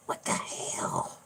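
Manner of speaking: slow, low, whispery puppet voice; Peanut impression. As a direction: drag it out low and hushed. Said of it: "do Peanut's voice".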